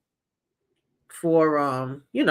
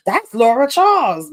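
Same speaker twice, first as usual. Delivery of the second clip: mad excited; 5 semitones higher, 3 dB louder than usual. Retold or imitated. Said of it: "mad excited and".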